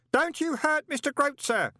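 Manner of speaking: very high, loud ringing warning. A narrator's reading. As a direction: ring it out as an alarmed boy. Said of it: "said a ringing voice".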